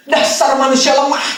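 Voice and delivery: slow, mocking "Dasar manusia lemah" with a laugh; mock scornful sneer. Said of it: "ngomong gitu".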